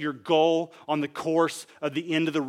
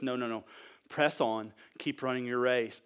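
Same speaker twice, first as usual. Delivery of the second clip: low, softened encouragement; gentle urging; 4 semitones lower, 5 dB quieter than usual. Acted. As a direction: drop your voice and urge them onward. Drops to gentle urging as Paul.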